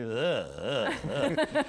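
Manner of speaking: sputtering laugh breaking into a news story; imitated Bobby Regan laugh. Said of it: "it kept going that way".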